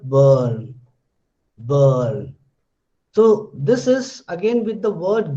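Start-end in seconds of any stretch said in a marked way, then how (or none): 0.0-2.3 "Burn" low, long vowel, r dropped; model pronunciation. Shown as a demonstration. Teacher modelling the word for students, long r-less "burn". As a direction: stretch "burn" without the r, pitched low. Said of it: "how will you say this word?".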